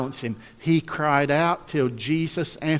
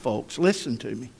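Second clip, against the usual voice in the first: ordinary delivery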